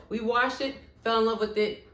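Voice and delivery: ordinary delivery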